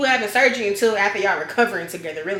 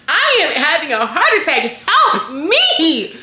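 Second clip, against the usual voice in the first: higher, louder, laughing mock panic; mock panicked plea; 6 semitones higher, 5 dB louder, slower than usual. Patient grabbing the doctor, higher laughing mock panic. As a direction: beg for help, hammed up and half laughing.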